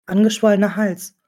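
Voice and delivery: ordinary talk, nothing marked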